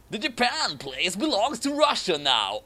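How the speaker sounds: lightly voiced Putin claiming land; a hint of a villain. Putin claiming Japan, lightly voiced villain.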